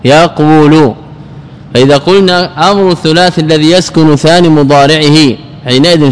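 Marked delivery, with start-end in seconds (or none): 0.0-0.9 "يقول" as yaq-WU-lu, qaf closing the syllable; underlying form yaqwulu. Shown as a demonstration. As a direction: stop on the qaf of "يقول", then say wu-lu. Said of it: "إذن الثاني ساكن". (نطق «يقول» بقاف ساكنة: يَقْوُلُ)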